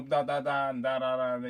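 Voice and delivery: normal talking voice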